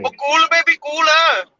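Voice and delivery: high-pitched mock Indian accent; catchphrase in hammed-up Indian accent. Shown as a demonstration. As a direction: demonstrate a put-on Indian accent, pitched well up. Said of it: "in an Indian accent".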